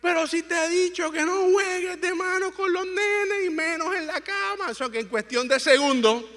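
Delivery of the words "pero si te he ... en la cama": high-pitched mock scolding; Sheribel scolding. Husband imitates wife scolding Adrián for rough play, high scolding. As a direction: imitate an exasperated mother telling off her son. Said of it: "Sheribel diciéndole a Adrián".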